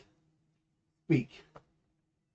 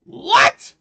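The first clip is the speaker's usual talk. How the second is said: startled shout; 8 dB louder than usual. Real, not acted. Shocked by sudden voice ban.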